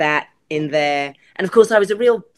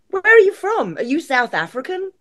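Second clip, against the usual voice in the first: high, louder imitation of a quizzer; higher-pitched mock inquisitive stranger; puzzled questioner; 12 semitones higher, 4 dB louder than usual. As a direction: imitate people nosily asking, pitched up. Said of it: "people say to me".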